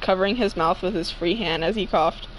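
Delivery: ordinary talk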